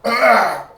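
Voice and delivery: loud grunt of disgust; disgusted ugh. Real, not acted. Comic recoiling in disgust, loud ugh. Disgust after mocking NPR.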